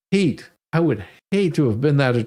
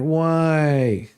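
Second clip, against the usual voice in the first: put-on annoying toddler. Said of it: "he had this most annoying voice".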